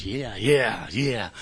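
low, soft, reluctant agreement; retold muted admission. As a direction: let it drop away under your breath. Retold sheepish answer to sponsor.

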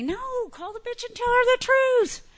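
a hint of a nagging inner voice. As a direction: push yourself to do it. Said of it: "something kept telling me".

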